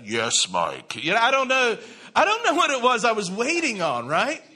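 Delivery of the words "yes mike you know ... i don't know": God's voice. Pretend. Imagined God answering the prayer.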